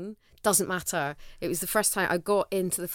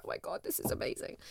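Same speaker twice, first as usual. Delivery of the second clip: hushed, awed whisper; amazed whisper; 9 dB quieter than usual. Retold or imitated. Back home with her cat, whispering in awe. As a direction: breathe it out in wonder. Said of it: "I was like".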